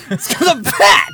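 high joking shout. Real, not acted. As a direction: yell it out for a laugh. Joking shout about himself.

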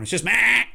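a bored flat horn "meh". Retold or imitated. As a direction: drone it like a dull horn. Mocking the flat horn sound.

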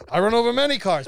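hammed-up Egyptian-accented English; Mahmoud. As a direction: boast loudly in a put-on Egyptian accent. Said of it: "but that's how he talked".

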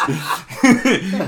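a loud, real laugh breaking out; burst of laughter. Real, not acted. Laughing while telling the sponsor story. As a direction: let the laugh burst out high.

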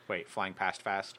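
normal talking voice

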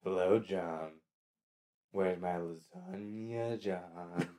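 low, quiet, slow creepy voice; lightly voiced horror cat; creepy Garfield. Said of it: "Where you do it, go".

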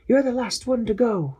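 high voice calling over radio; Quasi's voice. Acted. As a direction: call out anxiously for the captain.

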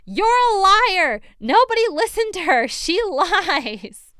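very high, very loud accusing shout; outraged yell. Retold or imitated. Mother in the gallery denouncing her daughter, yelled in imitation. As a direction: yell it full out, drawing out the words. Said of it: "and yelled".

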